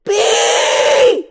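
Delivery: long, very high shout; desperate calling out. Acted. Moose Blood flung off wiper, shouting for Barry. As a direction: yell it as you're flung away. Mosquito flung away calls out.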